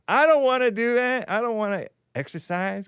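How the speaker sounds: put-on a petulant kid who won't exercise; whiny refusal. Refusing to work out.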